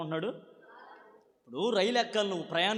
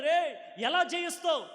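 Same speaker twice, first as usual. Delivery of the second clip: mocking shout; 8 semitones higher, slower than usual. Onlookers mock David's boast.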